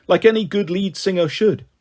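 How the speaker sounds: plain reading voice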